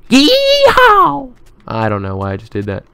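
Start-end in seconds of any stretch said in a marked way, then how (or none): 0.1-1.3 very high, loud rodeo holler; piercing drawn-out whoop; cowboy whoop. Pretend. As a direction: let out a long high cowboy cry. Cowboy whoop in put-on accent.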